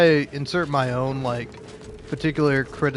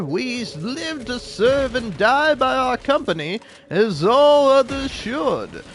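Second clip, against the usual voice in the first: grandiose company loyalist, swooping pitch; drawn-out, theatrical praise of the company; hammed-up capitalist bit; 6 semitones higher than usual. Shown as a demonstration. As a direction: ham it up as a pompous capitalist. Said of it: "I'm doing a bit".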